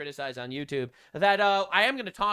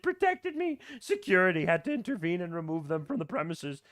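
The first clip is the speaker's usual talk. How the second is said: mocking victim voice; 5 semitones higher than usual. Mocking the influencer's assault story, higher whiny voice. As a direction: read her post like an overdone martyr. Reading her tweet mockingly.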